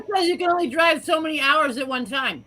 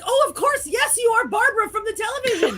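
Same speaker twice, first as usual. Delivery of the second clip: awed officials; 9 semitones higher than usual. Retold or imitated. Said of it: "they all somehow were like".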